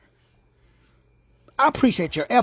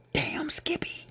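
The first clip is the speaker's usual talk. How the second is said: sly whispered aside; playful whisper; 8 semitones higher, 10 dB quieter, slower than usual. Real, not acted. Preacher confirming "you're God" with a cheeky whisper.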